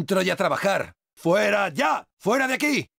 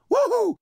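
high, elated whoop. Acted. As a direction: let out a triumphant cheer. Whoops at a kill.

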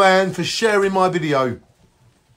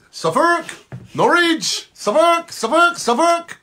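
calling out questioningly; 11 semitones higher, slower than usual. Acted. Calling out county names.

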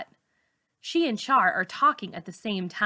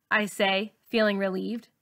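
usual speaking voice

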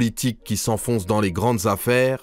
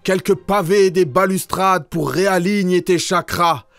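high-pitched, declaimed cry of protest; crowd crying out; 6 semitones higher than usual. A narrator's reading. Angry crowd heckling the banker in verse, high declaimed cry. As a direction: cry it out in verse, pitched well above the narration. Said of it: "Ces gens criaient".

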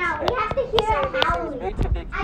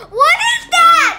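frightened shout; 8 semitones higher, 6 dB louder, slower than usual. Real, not acted. Scared kids spot something onscreen.

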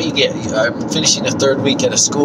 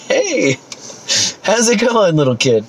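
cheerful greeting; 7 semitones higher than usual. Retold or imitated. His reply to kids.

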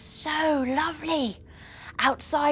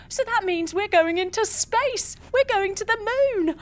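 excited; 7 semitones higher than usual. Acted. Announcing the trip to space.

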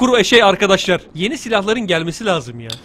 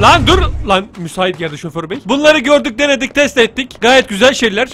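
usual speaking voice

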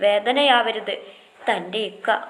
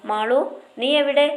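raised voice calling through the house; 5 semitones higher, 5 dB louder than usual. Acted. Older sister calls out to Malu somewhere in the house, loud call. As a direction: call for Malu from another room.

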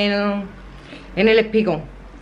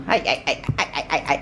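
cooing; 8 semitones lower, 5 dB quieter than usual. Real, not acted. Cooing at the wobbly dog.

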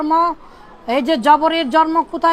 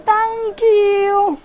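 put-on higher, drawn-out Arabic-accented English; 5 semitones higher than usual. Teacher showing how Arabs give thanks, Arabic accent. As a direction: say 'tank yoo' like an Arabic speaker. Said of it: "thank you কিভাবে দেয় জানেন?".

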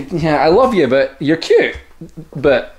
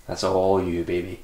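teasing murmur; 8 semitones lower, 10 dB quieter, slower than usual. Real, not acted. Teasing farewell.

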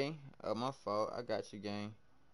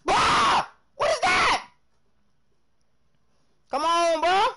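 sudden high shout of surprise; startled shout; 13 semitones higher, 9 dB louder, much slower than usual. Real, not acted. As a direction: yelp as if caught off guard. Startled by game play.

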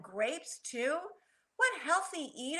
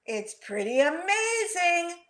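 put-on impressed kid in a read-aloud; amazed; 5 semitones higher, 4 dB louder than usual. A narrator's reading. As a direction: marvel aloud as a storybook child. Peter exclaims over the wish.

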